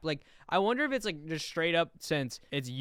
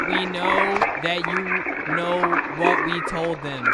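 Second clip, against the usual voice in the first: slow, deliberate reading with a threat that swells louder; menacing message read; 3 dB louder than usual. Acted. Streamer voicing the enemy's transmission to viewers, building to a slow, loud threat. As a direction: read it out like an ominous warning from a rival power.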